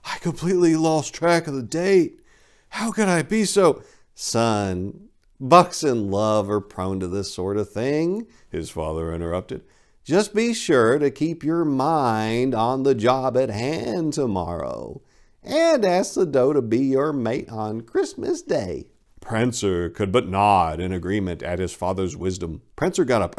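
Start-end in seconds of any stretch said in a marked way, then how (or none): 0.0-3.8 mild bashful exclaim. Acted. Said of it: "bashfully replied". 4.2-18.9 calm fatherly advice. Acted. Father interrupting son.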